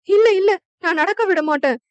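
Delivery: high, loud panicked scream; terrified wife screaming for help. A narrator's reading. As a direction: cry out for rescue in terror. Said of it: "என ரமணி கத்த".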